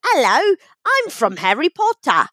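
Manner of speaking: swooping, hammed-up English accent; mock-British caricature, leaping up in pitch; exaggerated British accent. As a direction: imitate people overdoing a British accent. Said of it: "and they're very exaggerated".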